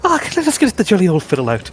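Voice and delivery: high, loud, merry Irish caricature; jolly Republic of Ireland. Acted. Comparing the two Irelands, as the jolly southern half. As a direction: burst out like a jolly fiddle-lover. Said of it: "somebody with a violent split personality".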